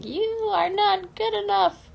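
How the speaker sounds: high, slow nagging inner critic. Retold or imitated. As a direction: sneer it like the little dude on your shoulder. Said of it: "little dude on your shoulder going".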